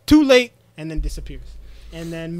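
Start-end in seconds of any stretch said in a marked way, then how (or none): 0.1-0.5 higher and louder mocking taunt. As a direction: toss it off like a villain escaping. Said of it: "And then he's like".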